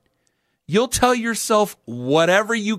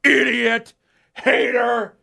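mock angry Patriots fan, higher; lightly voiced raging fan hurling insults; 6 semitones higher, much slower than usual. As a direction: fan firing insults at a Boston radio host, mock angry. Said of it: "immediately Patriots fans get in".

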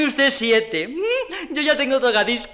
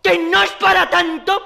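outraged retort; 5 semitones higher, 5 dB louder than usual. Acted. Outraged over ruined jacket.

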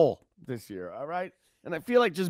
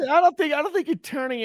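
exclaiming; 11 semitones higher than usual. Real, not acted. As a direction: blurt out in disbelief. Reacting to crude joke.

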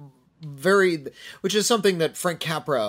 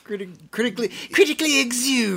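high ghoulish voice, building louder; Crypt Keeper; 9 semitones higher, 4 dB louder than usual. Acted. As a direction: announce it as a hammy horror host. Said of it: "I can't do my Crypt Keeper voice".

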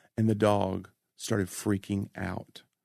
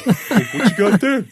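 much higher, louder, slow laughing dog voice; begging dog voice; 13 semitones higher, 10 dB louder, much slower than usual. Acted. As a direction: drag it out like a hungry pup. Voicing the dog begging food.

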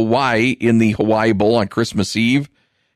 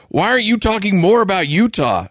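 higher mock Utah fan complaint; 10 semitones higher than usual. Host mocking Utah fans' constant complaints, higher mock voice. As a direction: play the complaining fan, pitched up. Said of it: "where they're like".

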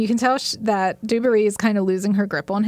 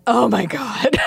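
shocked gasp; 2 semitones higher, slower than usual. Retold or imitated. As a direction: blurt it out while laughing. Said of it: "I literally went".

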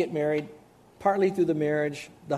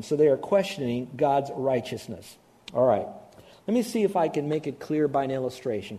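ordinary delivery

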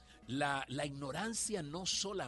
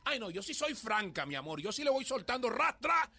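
higher, a lightly put-on brash voice; mock blunt person; 7 semitones higher than usual. Mocking people who brag about frankness, light mimicry. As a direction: mimic someone proud of speaking bluntly. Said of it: "la gente dice".

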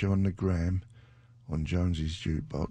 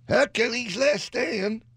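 very high, slow squeaky announcement; comic falsetto; 3 dB louder, slower than usual. Pretend. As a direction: stretch the joke title out in falsetto. Comic voice for altered song title.

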